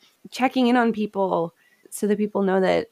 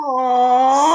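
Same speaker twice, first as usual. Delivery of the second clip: high, loud tender aww; touched aw; 7 semitones higher, 7 dB louder than usual. Real, not acted. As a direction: melt at something sweet. Touched by the son's note.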